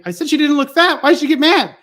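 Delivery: loud, high, indignant bafflement; baffled protest. Acted. Boyfriend complaining about girlfriend getting mad, loud protest. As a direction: protest loudly as the confused boyfriend.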